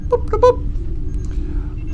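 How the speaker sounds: high, slow, breathy robot boops; answering as the spaceship computer; robot computer beeps. Acted. Pilot asks the ship computer about brakes, it answers in high slow robot boops. Asked to teleport him home.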